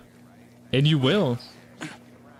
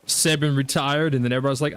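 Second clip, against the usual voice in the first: ordinary talk, nothing marked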